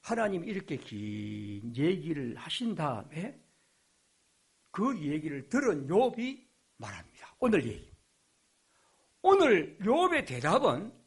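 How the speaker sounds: ordinary delivery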